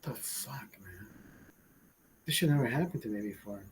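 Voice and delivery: breathy disbelieving whisper, quick at the end; stunned whisper. Retold or imitated. Talking to himself on the floor, stunned whisper. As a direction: whisper it to yourself, dazed on the floor. Inner thought lying under mess.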